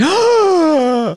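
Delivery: high, loud staged gasp; mock shocked gasp. People hearing someone hates Kit Kats, loud mock gasp. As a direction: gasp like it's an outrage.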